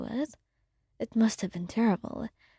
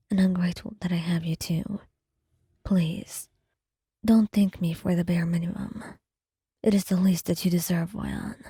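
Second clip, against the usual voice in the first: lower, hushed gentle reassurance; Neuvillette, gentle; 4 semitones lower than usual. Acted. Neuvillette answers the listener.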